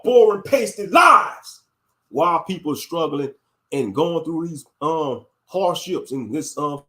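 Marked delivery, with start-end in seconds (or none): 0.0-1.6 slow, loud shouted scorn; real outrage, louder; angry shout. Real, not acted. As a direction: spit each word out slowly and loud.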